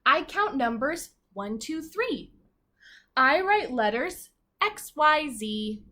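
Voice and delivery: slow, sing-song reading of the rhyme; read-aloud to children. Acted. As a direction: read it slowly to a class of little kids. Reading picture book aloud.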